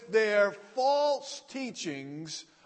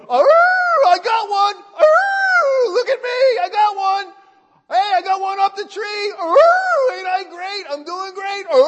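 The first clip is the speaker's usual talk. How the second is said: loud, high howling hound voice; bay and brag like a hunting dog on a deer; showing-off hound; 13 semitones higher, 15 dB louder than usual. Retold or imitated. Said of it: "have you ever seen a hound".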